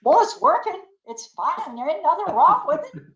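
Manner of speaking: laughing it off. Retold or imitated. Sister brushing off worry.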